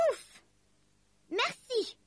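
puffed sigh, then high thanks; little elf voice giving relieved thanks. A narrator's reading. Tired elf thanks Lucie.

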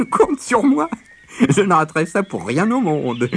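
chuckled, high, loud character voice; chuckling tortoise. A narrator's reading. Tortoise eagerly promising the rabbit to watch, chuckling high. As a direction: gleefully cluck it out, pitched well up. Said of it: "gloussa Mère Tortue".

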